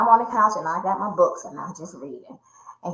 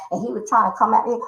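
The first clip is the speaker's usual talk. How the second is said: ordinary delivery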